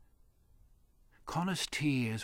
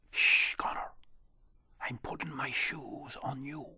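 a secret unvoiced whisper. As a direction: whisper so no one hears. Leprechaun secretly helps Connor.

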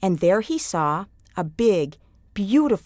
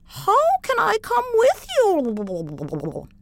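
very high, slow, wondering voice; the brook puzzling how to travel; water in the brook; 13 semitones higher, much slower than usual. A narrator's reading. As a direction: ask slowly in a high watery voice. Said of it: "asked the water in the brook".

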